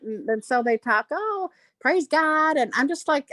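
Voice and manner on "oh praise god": slow, higher drawn-out Southern church drawl; mock Southern accent. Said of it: "They talk with that accent".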